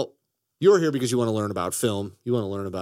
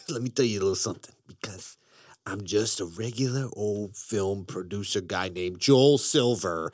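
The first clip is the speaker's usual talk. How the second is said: lightly put-on Jersey accent, a bit quieter; mock New Jersey accent; 5 semitones lower, 4 dB quieter than usual. Host slips into a light New Jersey accent as Joel Silver. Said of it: "that accent is not going to stop".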